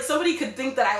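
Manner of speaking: ordinary talk, nothing marked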